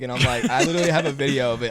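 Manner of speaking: ordinary delivery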